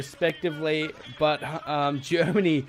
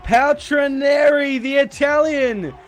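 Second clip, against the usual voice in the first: rising, shouting the race finish; excited commentary; 8 semitones higher, 6 dB louder than usual. Real, not acted. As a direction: call the finish at full roar. Race finish, commentator calls winner.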